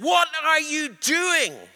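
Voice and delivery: high, slow shriek of a spirit; the spirit shrieks at the preacher in alarm, high shriek; shrieking demon. Retold or imitated. Spirit questions the minister, shrieking high. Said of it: "it shrieked and said".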